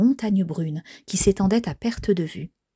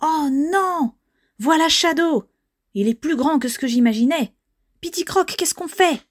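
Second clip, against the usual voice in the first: high, panicky cartoon voice; alarmed; 8 semitones higher, 3 dB louder than usual. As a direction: cry out in alarm as the villain appears. Friends discover the villain Shadow. (voix aiguë et affolée)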